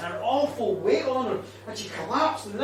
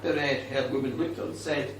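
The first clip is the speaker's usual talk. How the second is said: usual speaking voice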